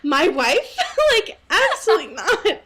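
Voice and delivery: high, loud, laughing objection; mock indignation. Host voicing Edward to co-host, mock indignation. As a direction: play the affronted husband, half laughing.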